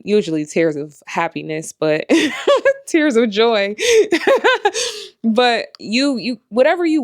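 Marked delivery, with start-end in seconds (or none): 2.1-2.8 laugh. Real, not acted. Laughs about tears of joy. 3.8-5.2 laugh. Real, not acted. Laughs about tears of joy.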